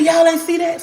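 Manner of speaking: high, loud astonished question; girl stunned that nobody else saw the angel, high and loud; amazed disbelief. Retold or imitated. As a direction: burst out in disbelief. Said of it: "I said".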